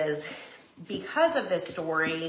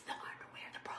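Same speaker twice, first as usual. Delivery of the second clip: whispered aside; 21 dB quieter, faster than usual. Real, not acted. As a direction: whisper the embarrassing words. Embarrassed aside about underwear.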